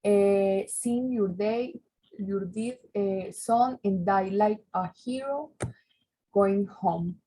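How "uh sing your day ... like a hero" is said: ordinary delivery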